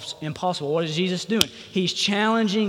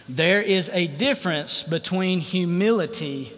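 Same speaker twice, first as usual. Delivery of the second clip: ordinary delivery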